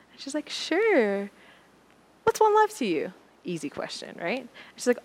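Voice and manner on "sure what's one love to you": much higher, brighter voicing of the seatmate; Cece's voice. Cece turns the question back, much higher voice. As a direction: lift the voice way up to play her.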